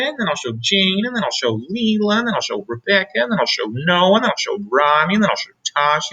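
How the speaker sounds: put-on high, eager child's voice rattling off names; excited little girl. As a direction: bubbly kid listing every friend. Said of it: "Trixie really knew how to talk".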